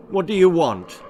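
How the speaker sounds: loud, firm question; brave challenge. A narrator's reading. Thor faces the troll leader, brave. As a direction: stand up to the beast. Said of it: "asked Thor bravely".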